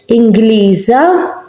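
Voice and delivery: inglisa with a buzzing z; with Z sound. Shown as a demonstration. Teacher demonstrating inglisa with a z. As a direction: say inglisa with a voiced z, slowly. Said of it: "We say inglisa".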